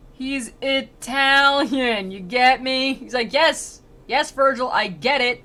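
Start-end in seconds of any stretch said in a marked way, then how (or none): 0.1-2.9 loud, high, very slow mock explanation; exaggerated prompting. Virgil over-explains to his pupil Dante, exaggerated prompting. 3.3-5.4 loud, higher mock exasperation; exasperated. Dante brushes off Virgil's repeated prompt, exasperated. Acted impatient retort to Virgil.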